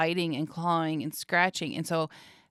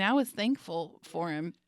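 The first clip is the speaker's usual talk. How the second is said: normal talking voice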